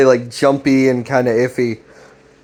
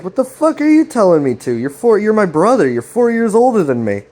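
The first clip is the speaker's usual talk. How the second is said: much higher, heated mock protest; indignant argument; 8 semitones higher than usual. Greg arguing back at his brother, acted indignation. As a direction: act out arguing back, pitch jumping up.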